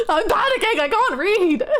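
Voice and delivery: high, loud flustered panic; panicking. Real, not acted. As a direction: blurt it out in a panic.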